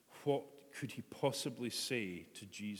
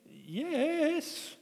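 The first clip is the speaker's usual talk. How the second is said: high, loud yes; loud high-pitched insistent yes; 8 dB louder than usual. Acted. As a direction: answer urgently, almost pleading. Peter answers second time.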